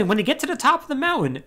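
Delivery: ordinary talk, nothing marked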